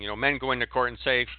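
ordinary delivery

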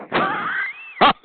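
sudden very high shriek of a laugh. Real, not acted. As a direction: let a mocking laugh fly up high. Mocking the caller on chatline.

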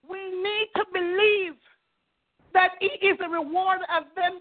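fervent preaching. Acted. Preacher assuring the congregation God keeps promises, fervent. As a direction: drive the promise home to the church.

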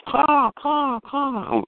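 high-pitched imitation of a faraway crow; thin, high crow mimicry; distant real crow. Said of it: "way back in the distance, a crow goes".

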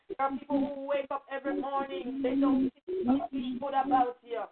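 ordinary delivery

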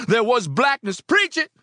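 high, loud, fervent sermon call; preacher shouting. A narrator's reading. Preacher calls to the congregation, shouting. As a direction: preach it at full force. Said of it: "the preacher shouted".

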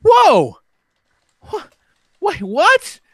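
high, loud mock alarm; retold shock. Telling friends how he reacted to the dead horse, high startled yelp. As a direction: blurt it out in startled disbelief. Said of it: "I said".

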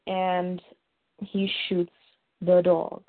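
ordinary talk

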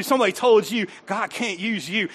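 ordinary talk

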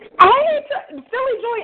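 high, loud delighted hello; excited greeting. Real, not acted. Host greets friend calling in, excited high shout. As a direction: burst out greeting a favourite friend.

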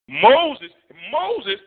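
loud, high call of Moses; rising preacher's call of the name. Real, not acted. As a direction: call the name out across the room.

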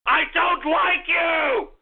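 slow, emphatic angry yell; angry shout. Real, not acted. Host telling listeners he doesn't like them, angry shout. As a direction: shout each word at them.

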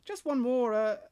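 casual Australian survey voice, pausing before the punchline; Australian accent. Acted. Prank caller slipping in a rude last question, Australian accent. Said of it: "eventually it would just be like".

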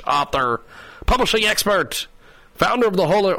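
ordinary talk, nothing marked

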